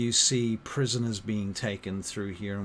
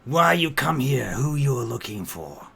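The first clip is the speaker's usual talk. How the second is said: loud, raised orc voice in broken speech; orc child, demanding; 4 semitones higher, 9 dB louder than usual. Acted. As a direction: demand answers like a wary little orc.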